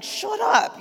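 high, pointed mock shut up; imitated warning to husband; silent shut up look. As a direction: fire a mock shut up at him. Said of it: "I'm looking at him like".

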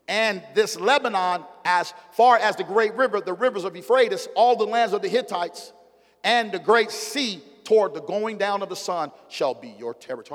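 loud, high preacher's cry of God's words; booming pulpit proclamation; full-force preaching. Acted. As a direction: preach it out, rising and ringing.

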